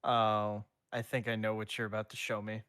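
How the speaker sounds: ordinary talk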